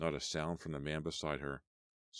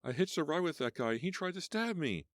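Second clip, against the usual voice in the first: much higher, rushed and desperate; high panicked explanation; frantic explaining; 12 semitones higher than usual. Acted. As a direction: get it out fast in fear.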